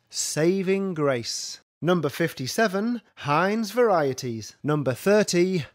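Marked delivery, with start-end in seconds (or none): none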